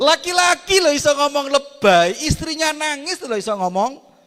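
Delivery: high, loud indignant exclaim; mock outrage. Real, not acted. Preacher scolding men who call crying wives dramatic, loud and high. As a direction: throw up your hands at men.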